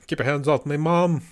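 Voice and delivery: lilting mock-Scottish quote; Scottish dialect. American listener quoting Scots to a Scottish friend, imitated Scottish accent. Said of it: "some of the Scottish would do".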